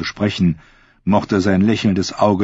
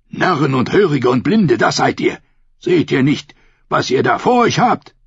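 higher, scornful priest; angry priest's rebuke; 7 semitones higher, 3 dB louder than usual. A narrator's reading. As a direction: berate the fools, pitch rising. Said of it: "fauchte der Priester". (wütend scheltender Priester)

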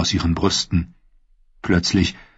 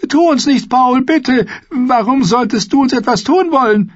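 high, louder, trembling plea; frightened pleading; 4 dB louder than usual. Acted. Sam pleads with the dead Paul, terrified begging. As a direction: beg him to remember you.

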